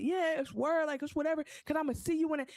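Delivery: mock-confident kid's answer; kid bravado. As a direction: brush it off like a tough kid. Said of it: "He's like".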